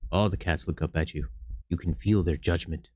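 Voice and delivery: low, soft, heavy narration; ominous narration. A narrator's reading. Game master describes judging cats, softly ominous. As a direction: let the judgment weigh in.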